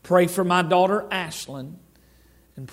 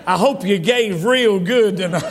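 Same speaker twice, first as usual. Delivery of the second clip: louder, laughing tease; joking laugh; 5 dB louder than usual. Real, not acted. Preacher teasing the congregation about giving, laughing. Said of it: "That's a joke".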